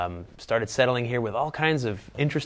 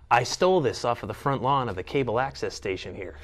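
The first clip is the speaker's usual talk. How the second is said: normal talking voice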